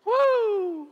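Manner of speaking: high, jubilant "woo"; real excited church holler; praise whoop. Real, not acted. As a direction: whoop out for the Lord. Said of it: "give the Lord a little woo".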